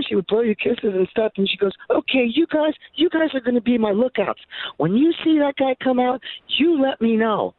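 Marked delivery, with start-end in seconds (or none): 1.9-7.5 high-pitched imitation of a woman; bossy admirer. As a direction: give eager orders to your lookouts, high and emphatic. Plays the woman instructing her lookouts.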